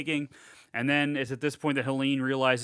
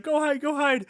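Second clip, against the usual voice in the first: mock frantic urging; 12 semitones higher than usual. Voices mother urging kids to hide.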